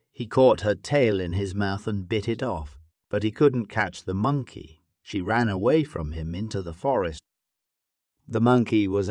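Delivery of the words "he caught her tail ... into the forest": plain reading voice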